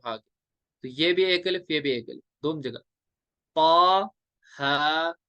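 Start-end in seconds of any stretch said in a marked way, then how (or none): 3.6-5.1 "पा, हा" as two separate, evenly held syllables; one-alif length. Shown as a demonstration. Teacher showing students the right reading, measured. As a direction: hold "पा" and "हा" briefly, each apart. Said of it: "ऐसी".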